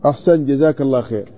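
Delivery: usual speaking voice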